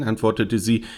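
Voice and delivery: plain reading voice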